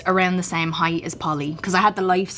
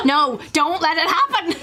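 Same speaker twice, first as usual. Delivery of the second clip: mock horror; 10 semitones higher than usual. Mock plea against dethroning Nemo.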